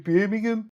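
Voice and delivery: hammed-up Birmingham accent; local Birmingham accent. Shown as a demonstration. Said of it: "You can do it with the accent".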